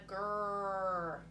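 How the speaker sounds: "grrr" drawn out slowly as a letter sound; sounding out blend. Shown as a demonstration. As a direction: hold the r in "grrr". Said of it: "remove the T sound".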